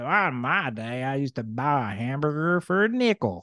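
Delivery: put-on old-timer, words stretched out; old person accent. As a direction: reminisce like a rambling old person. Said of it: "That was my old person accent".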